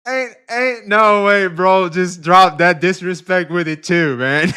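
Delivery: loud, high, slow outcry; loud mock outrage at the donor; disbelieving protest. Real, not acted. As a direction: protest loudly, half laughing. Mock outrage at donation message.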